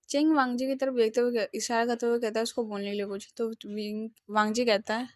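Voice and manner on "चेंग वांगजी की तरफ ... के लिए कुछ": usual speaking voice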